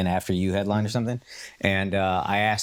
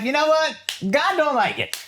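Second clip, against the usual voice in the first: much higher, louder mock voice; mock preachy local; 3 dB louder than usual. Locals explain God's will to a comedian, high and loud. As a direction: put on a high, loud, sure voice. Imitating Malaysians' answer.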